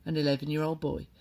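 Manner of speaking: usual speaking voice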